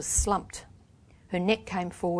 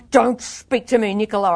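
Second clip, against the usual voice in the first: raised, louder cross rebuff; angry retort; 3 semitones higher, 7 dB louder than usual. A narrator's reading. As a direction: refuse to talk, livid. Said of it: "I muttered, turning away".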